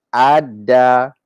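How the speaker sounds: adda slowly with a doubled d; adda, no l sound; assimilated adda. Shown as a demonstration. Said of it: "we don't say alda".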